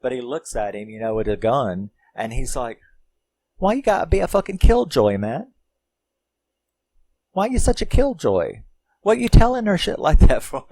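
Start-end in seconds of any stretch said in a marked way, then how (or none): 3.6-10.7 high, loud mock-wasted whine; Kenny. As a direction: imitate a drunk guy's comic protest. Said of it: "'cause he's all fucking wasted and shit".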